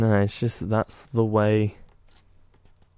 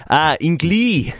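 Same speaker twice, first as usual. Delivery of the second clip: put-on slow, higher-pitched French accent; put-on French pronunciation, raised and stretched; 4 semitones higher, slower than usual. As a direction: imitate a Frenchman, drawing the word out slowly. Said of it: "when the Frenchman spoke about Hinckley".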